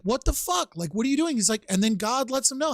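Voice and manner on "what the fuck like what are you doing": Jonah's retold outrage. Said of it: "again, he's like".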